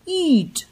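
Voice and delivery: ईंट with a long, pressed ईं, pitch high; ईंट, ईं पर पूरा ज़ोर; forceful long ee. Shown as a demonstration. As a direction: push the ईं of ईंट with extra force. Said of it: "इसको बोलने में ज्यादा फोर्स लग रहा है".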